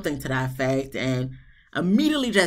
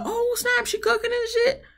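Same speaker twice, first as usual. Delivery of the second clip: much higher, thrilled surprise; excited oh snap. Retold or imitated. Host first thinking Katina cooked, jumping way up with excitement. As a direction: squeal it out, delighted. Host's impressed first reaction.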